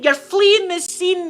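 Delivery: high, loud, drawn-out accusation; mock police shout. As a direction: call out like an officer accusing a fugitive. Acted police shout at suspect.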